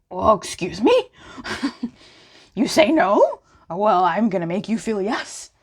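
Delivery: cocky, teasing put-on voice; mock dominant. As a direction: lean in like a playful seducer. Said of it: "that sense of".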